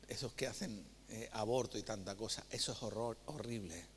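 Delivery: grave hush. Real, not acted. Grave point about unborn child.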